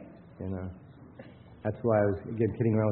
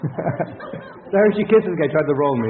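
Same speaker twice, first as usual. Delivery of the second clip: slow, louder quote broken up with laughter; laughing retelling; 5 semitones higher, 8 dB louder, slower than usual. Retold or imitated. Retelling the Hershey Kiss story to the group, laughing. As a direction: laugh your way through the quote. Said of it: "a very heavy South Philly accent".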